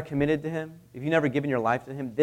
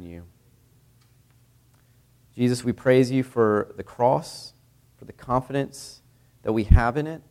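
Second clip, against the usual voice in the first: prayer; 4 semitones lower than usual. Acted. Pastor leads the church in closing prayer, reverent.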